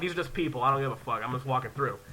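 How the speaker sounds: low, soft, unbothered self-talk; dismissive. Retold or imitated. Haunted house visitor shrugging off the actors, dismissive. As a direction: shrug it off quietly, voice dropped.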